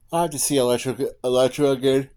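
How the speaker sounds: yawning. Real, not acted. Vlogger apologizing to viewers, yawning mid-sentence. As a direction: talk right through a yawn. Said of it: "I yawn a lot".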